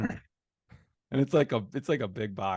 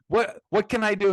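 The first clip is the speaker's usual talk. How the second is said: high, loud exasperated plea; mock-heated outburst, pitched up; retold heated protest; 10 semitones higher, 6 dB louder than usual. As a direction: imitate yourself getting heated in an argument. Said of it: "I'm kind of, like, heated".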